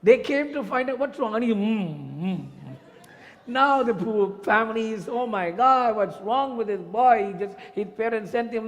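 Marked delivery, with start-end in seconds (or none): 1.5-2.5 slow, closed-mouth hums; slow muffled mm sounds, lips sealed. Acted. Mouth stuffed with tomatoes. 5.1-7.3 higher, louder, slowed alarm; the family fearing for the sick boy; high, loud, slow oh my God; panicked family. Acted.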